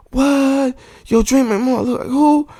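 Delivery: high incredulous imitation of a surprised woman; mock shocked woman. Imitating a woman reacting to his drawing, high and incredulous. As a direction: play her disbelief high and wide-eyed. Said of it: "She's like".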